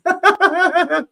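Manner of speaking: high giggling burst; can't stop laughing. Real, not acted. Streamer still laughing at the text, high laugh.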